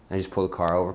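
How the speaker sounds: ordinary delivery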